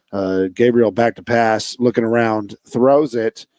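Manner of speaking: mock play-by-play call; announcer. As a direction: call the game like a sportscaster. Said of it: "auditioning to be an announcer".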